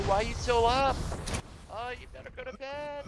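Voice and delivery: lightly mock the mom telling him to bed. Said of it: "your mama asking you".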